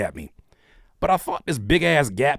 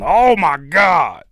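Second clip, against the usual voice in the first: slow, drawn-out loud outburst; loud awed exclamation; 3 semitones higher, 7 dB louder, much slower than usual. Real, not acted. Host seeing her Crip walk, loud and amazed. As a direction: belt it out, stretching each word.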